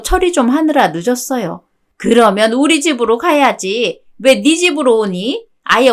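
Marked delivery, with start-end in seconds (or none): none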